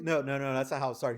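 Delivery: ordinary talk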